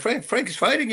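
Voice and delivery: high-pitched puzzled question; retold surprise. Fighter on the phone hearing of his forgotten fight, retold surprise.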